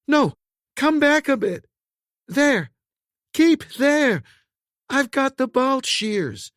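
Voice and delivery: high, loud commands over the din; urgent shouted directions. A narrator's reading. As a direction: guide him fast, shouting. Woman directing trapped man urgently.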